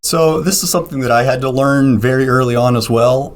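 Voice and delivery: usual speaking voice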